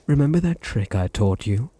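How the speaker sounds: usual speaking voice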